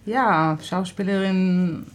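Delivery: usual speaking voice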